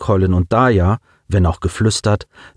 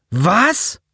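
high, shocked one-word outcry; startled boy's exclamation; exclaimed; 11 semitones higher than usual. A narrator's reading. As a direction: cry out in disbelief, pitched way up. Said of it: "rief Colin aus".

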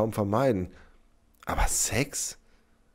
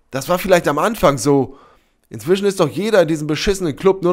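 high, loud, exasperated tirade; furious shouting; 10 semitones higher, 10 dB louder than usual. A narrator's reading. Sybil reveals the club's aim to Sepp, furious. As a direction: hammer the truth into him angrily. Furious explanation to uncomprehending man.

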